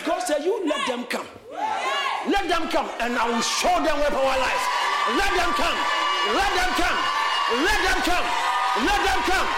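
high shouted sermon, building with each repeat; full-force preaching. Real, not acted. Preacher daring the congregation to praise God, full-force preaching. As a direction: roar the refrains at the crowd.

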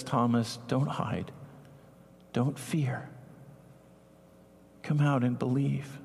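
soft coaxing words to a fearful Thomas; gentle plea. Acted. Jesus gently calling Thomas out.

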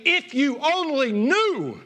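high, impassioned, drawn-out plea; acted outburst of a desperate parent; pained parent's scream. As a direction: cry it out like a hurt parent, pitch soaring. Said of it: "wants to scream and say".

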